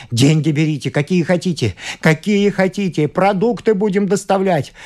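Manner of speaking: desperate pleading. A narrator's reading. Father offers the doctor anything, desperately pleading. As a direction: beg harder with every phrase. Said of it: "кричал он в потолок".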